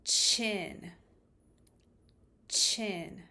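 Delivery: "chin" said loud and crisp, falling; clear word. Shown as a demonstration. Therapist presenting the word chin. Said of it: "the first word is".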